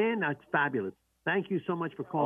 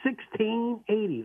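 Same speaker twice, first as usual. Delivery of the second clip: slow, clear number read; 6 semitones higher, slower than usual. Shown as a demonstration. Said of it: "Let me repeat that nice and slow".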